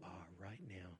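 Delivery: sudden soft unvoiced whisper. Real, not acted. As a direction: drop to a hush.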